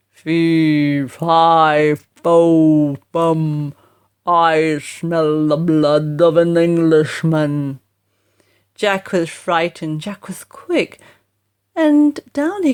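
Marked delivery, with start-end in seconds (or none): 0.1-7.8 low, loud booming giant; giant's voice. A narrator's reading. The giant above Jack's head, booming low. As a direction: rumble it like a huge giant. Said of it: "It shouted loud".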